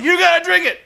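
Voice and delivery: high, loud whooping wrestler impression; hammed-up jubilant shouting with big whoops; Ric Flair celebrating. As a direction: holler it like a showboat mid-party. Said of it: "Ric Flair's all celebrating".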